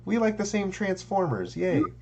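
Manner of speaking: put-on high squealing kid voice; childlike glee. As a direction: gush like a little kid. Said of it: "like a child".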